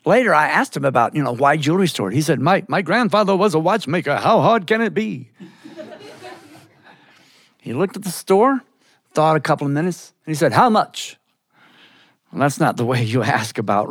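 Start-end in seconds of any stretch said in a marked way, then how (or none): 2.4-5.3 high, singsong New York Yiddish voice; dismissive New York Yiddish uncle; New York Yiddish accent. Acted. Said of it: "that New York Yiddish accent". 10.5-11.2 blunt, higher New York Yiddish question; haggling New York Yiddish uncle; New York Yiddish accent. Acted. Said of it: "that New York Yiddish accent".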